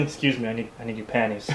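softly and politely; quiet polite voice. Pretend. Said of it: "Just bust into".

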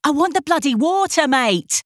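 irritable, pitched high, bloody stressed. A narrator's reading. Charlie irritably demands water from the bartender, pitched high. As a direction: snap it with English irritation. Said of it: "she said irritably".

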